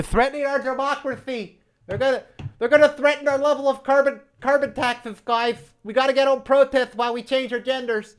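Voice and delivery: a lightly voiced panicky activist; mock alarmist. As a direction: parody the fearmongers.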